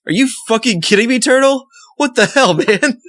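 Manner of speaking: high, exasperated mock outrage. Listener venting at the turtle in high mock outrage. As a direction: exclaim at the turtle in disbelief. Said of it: "and I'm like".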